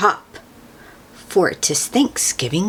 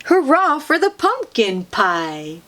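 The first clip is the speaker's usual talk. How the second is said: usual speaking voice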